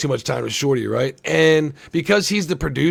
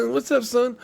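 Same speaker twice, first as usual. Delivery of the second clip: a bit higher, half-hearted mock greeting; lighter Shorty impression; 6 semitones higher, 3 dB quieter than usual. Showing the actor slipping in and out of his Shorty caricature. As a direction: toss off a lightly voiced Shorty. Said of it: "I'm Shorty, watch this".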